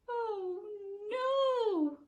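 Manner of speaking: high, wailing oh no; dismayed cry. Acted. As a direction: cry out in dismay at the closed shop. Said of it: "cried George".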